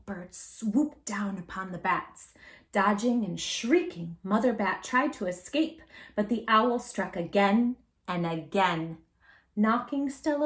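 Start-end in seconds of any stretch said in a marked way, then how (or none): none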